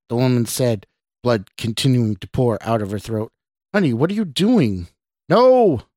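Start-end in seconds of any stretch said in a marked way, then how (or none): 3.7-4.9 higher, lightly voiced woman; alarmed woman. A narrator's reading. Woman sees Scott draw a knife, alarmed. 5.3-5.8 high, loud scream. A narrator's reading. Scott charges at the woman, screaming. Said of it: "Scott screamed".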